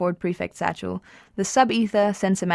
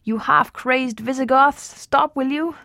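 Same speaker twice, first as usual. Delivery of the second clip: bawling; 6 semitones higher, slower than usual. A narrator's reading. Arthur shouting at demolition crew.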